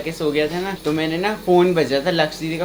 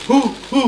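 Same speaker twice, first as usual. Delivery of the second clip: mock moaning; 7 semitones higher than usual. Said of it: "जैसे दीदी करती है ना".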